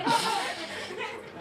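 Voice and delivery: high breathy laugh; high giggle. Acted. After poodle owner voice.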